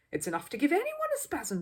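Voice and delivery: tutting lady in the crowd; sympathetic lady. Acted.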